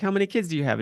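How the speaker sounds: curious comedian. Retold or imitated. Retelling comedian asking a mom.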